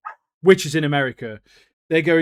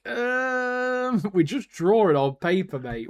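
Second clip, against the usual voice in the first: high, held-out stalling hum; mock awkward hesitation; 5 semitones higher, slower than usual. Magic users stumped by demands, mock hesitant. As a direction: imitate someone stuck for an answer.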